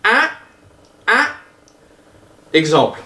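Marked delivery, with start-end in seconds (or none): 0.0-1.3 "un" as a short nasal A shooting up high. Shown as a demonstration. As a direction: clip "un" through the nose, pitch rising sharply. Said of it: "il est nasal et court". (« un » nasal, court et très montant)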